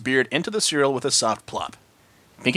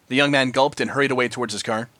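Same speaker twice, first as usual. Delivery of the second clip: ordinary delivery, nothing marked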